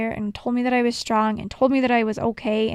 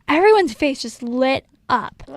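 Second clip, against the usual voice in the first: usual speaking voice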